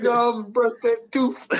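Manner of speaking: high, loud taunting; mock taunt. Friend chiming in with a quote, high loud taunt. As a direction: sneer it out at him.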